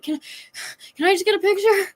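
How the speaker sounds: high, breathless "can I just get a picture?"; breathless plea. Retold or imitated. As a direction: imitate pleading while out of breath. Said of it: "I'm out of breath".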